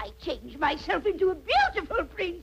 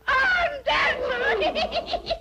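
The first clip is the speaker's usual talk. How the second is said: cackling witch; 8 semitones higher, much slower than usual. Acted. Witch gleefully cackling about locking up Rapunzel. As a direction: crow it out and cackle.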